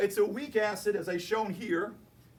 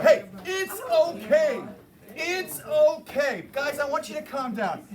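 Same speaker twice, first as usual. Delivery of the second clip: hammed-up slow reassurance, higher and louder; mock soothing; 8 semitones higher, 7 dB louder, slower than usual. Teacher mock-calming the class about the unknown base, hammed-up slow soothing. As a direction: drawl it out like calming a panicked crowd.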